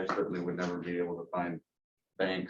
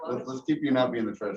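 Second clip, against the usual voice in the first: laughing; 5 semitones higher, 4 dB louder than usual. Real, not acted. Teasing a board member.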